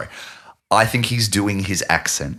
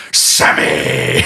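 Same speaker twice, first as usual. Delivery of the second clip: Adam Sandler-style yell. Retold or imitated. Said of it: "both times he says".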